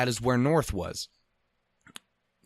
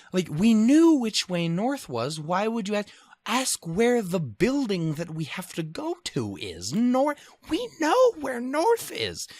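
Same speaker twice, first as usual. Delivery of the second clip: exasperated rant; 8 semitones higher, slower than usual. Real, not acted. Traveler complaining about mom asking again, exasperated and higher. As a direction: vent at the pointless question.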